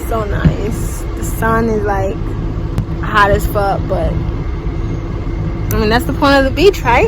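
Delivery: loud, projected voice; talking over the wind. Real, not acted. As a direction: shout over the beach noise. Loud at windy beach.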